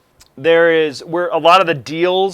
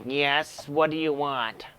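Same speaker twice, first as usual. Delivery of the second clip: softer, put-upon tech; a bored tech annoyed at the interruption; grumpy tech voice; 8 dB quieter, slower than usual. Acted. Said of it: "was the perfect voice".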